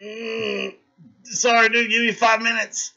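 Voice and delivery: high, embarrassed apology at the urinal; high mock bathroom guy. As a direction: squeak it out like a caught-out guy. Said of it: "the guy at the urinal like this".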